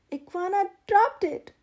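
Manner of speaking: high, loud pouting protest. A narrator's reading. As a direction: push the blame in a pout. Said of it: "pouted Pig".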